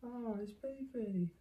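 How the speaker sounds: soft high cooing. Real, not acted. Introducing the baby to the dog in soft high coo.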